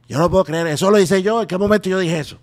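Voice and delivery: loud, high-pitched imitated incredulity; imitated disbelief. Impressionist retelling the last star's reaction to the video, imitation voice. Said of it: "Me dijo".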